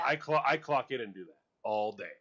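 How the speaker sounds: retold quote, punched out loud; loud boast. Retelling what the bud pruner says of his job, loud and emphatic. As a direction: play the proud worker, loudly.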